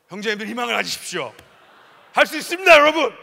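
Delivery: loud, high rallying cry; rousing shout. Real, not acted. Pastor rallying the young men, shouting loud and high.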